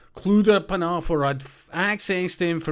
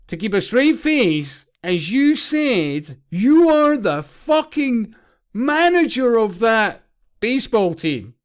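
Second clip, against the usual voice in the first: exasperated; 6 semitones higher, 7 dB louder than usual. Real, not acted. Exasperated rant about manager.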